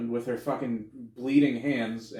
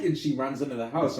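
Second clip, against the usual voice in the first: normal talking voice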